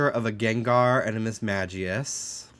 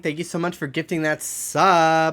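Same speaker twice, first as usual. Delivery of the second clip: excited thanks; 6 semitones higher, 5 dB louder than usual. Real, not acted. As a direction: greet the gifter with delight. Thanking a sub gifter.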